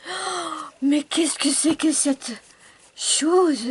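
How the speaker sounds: loud, high astonished cry; amazed. Real, not acted. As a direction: marvel out loud at the pastel.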